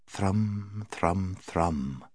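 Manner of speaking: soft, monotonous string noise; thrumming strings. A narrator's reading. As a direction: hum out the words hypnotically, softly. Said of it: "went the strings of the witch's instrument".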